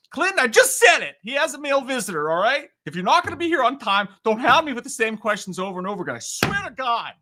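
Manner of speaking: high, loud, hammed-up scolding; mock exasperation. Streamer scolding a repetitive viewer as a joke, high mock outrage. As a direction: blow up at them in pretend fury.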